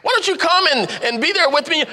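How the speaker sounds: gushing invitation, pitch climbing; eager pitch. Retold or imitated. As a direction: sell the event to a friend with growing excitement. Pitching event to a green friend.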